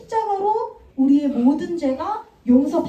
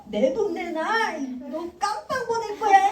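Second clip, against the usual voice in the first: higher, threatening yell; angry threat; 7 semitones higher than usual. Acted. Servant threatening his friend with jail, mock angry yell. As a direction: threaten him like a bully. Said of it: "이러고 있는 거예요".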